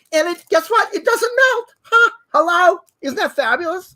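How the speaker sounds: high-pitched gushing reveal; excited reveal. Real, not acted. Host showing co-host a heat-proof ruler, gushing excitedly. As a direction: make the big reveal with glee.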